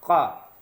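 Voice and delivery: का from the back of the throat, like Urdu qaf; deep throat k. Shown as a demonstration. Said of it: "आप बड़ा काफ निकालिए".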